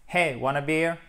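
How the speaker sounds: clipped casual offer, words run together; quick casual contraction. Shown as a demonstration. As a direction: toss it off fast like a mate. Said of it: "Boum, ça va dix fois plus vite".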